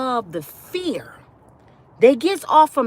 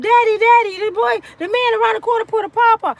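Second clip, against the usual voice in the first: put-on child reporting; 8 semitones higher, 3 dB louder than usual. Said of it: "My son came home".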